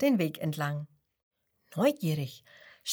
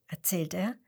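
plain reading voice

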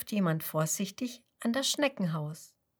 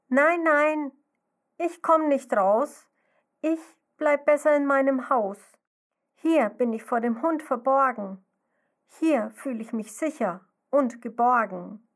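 scared little snail; 5 semitones higher, 5 dB louder than usual. Acted. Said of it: "Sie ruft aus ihrem Häuschen".

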